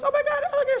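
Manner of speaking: high squealing mock panic over an alligator. A woman spotting an alligator at home, mock panicked squeal. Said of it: "A woman, if she goes".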